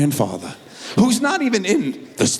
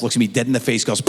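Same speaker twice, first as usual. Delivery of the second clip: usual speaking voice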